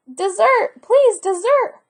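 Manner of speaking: high, eager begging for dessert; eager cry. A narrator's reading. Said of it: "cried the boy".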